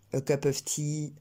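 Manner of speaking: "tea" with a lengthened i, no puff of air after the t; French-accented "tea", vowel drawn out slowly; accent français, i allongé. Shown as a demonstration. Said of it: "il faut allonger un peu le i". (« tea » à la française, avec le i allongé)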